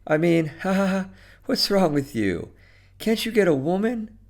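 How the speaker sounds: high-pitched, quick-talking woman with a little laugh; high, hurried phone-call voice; embarrassed woman caller. Acted. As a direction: laugh awkwardly, then question him in a high voice. Nervous phone call, teasing Joe.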